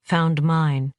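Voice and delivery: ordinary delivery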